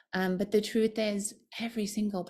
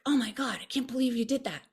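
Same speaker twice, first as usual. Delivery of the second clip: a hint of a scolding inner critic, higher and quick; 5 semitones higher, faster than usual. Her own harsh inner voice scolding her mid-hike, fast and higher. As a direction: rattle it off like a nagging voice in your head. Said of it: "this one voice that was like".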